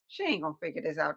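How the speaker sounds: low, soft, quick doubting aside; inner thought. Retold or imitated. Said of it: "That's what's going on in my mind".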